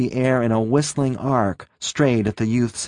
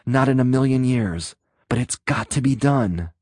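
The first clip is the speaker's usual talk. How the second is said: ordinary delivery, nothing marked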